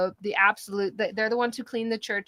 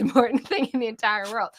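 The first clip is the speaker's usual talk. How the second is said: normal talking voice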